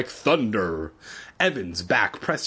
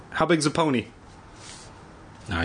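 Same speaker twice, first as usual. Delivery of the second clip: ordinary delivery, nothing marked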